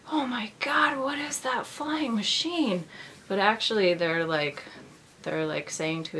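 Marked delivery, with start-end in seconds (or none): 0.1-2.9 high-pitched, wonderstruck gasping question; mock awe. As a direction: play an amazed onlooker gazing up, drawn out at the end. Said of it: "looking up and being like".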